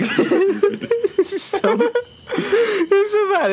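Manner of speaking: high, squeaky laughter through the words; laughing hard. Real, not acted. Storyteller cracking up over his own tale, laughing hard. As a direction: barely get it out for laughing.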